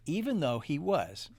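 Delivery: plain reading voice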